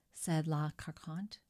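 plain reading voice

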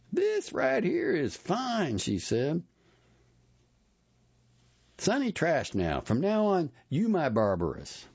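a hint of a pleased lady customer; woman customer. A narrator's reading. Satisfied customer naming her the Barbaress, lightly voiced.

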